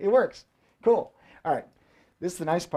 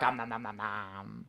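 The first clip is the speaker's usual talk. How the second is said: dinosaur munching; 10 semitones lower, 15 dB quieter, slower than usual. Pretend. T-Rex munching bit.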